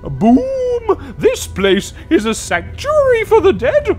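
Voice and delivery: high, loud spirit fury; angry booming ghost. Acted. Guardian spirit scolding the intruder, high and booming. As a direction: rail at the insolent mortal.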